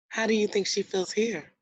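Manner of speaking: normal talking voice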